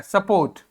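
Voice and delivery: "सपोर्ट" with weak first vowel and full o; full o sound. Shown as a demonstration. Teacher repeating support for students, "सपोर्ट" with full o after quick uh. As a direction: lean on the o of "सपोर्ट". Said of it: "ओ को पूरा pronounce किया".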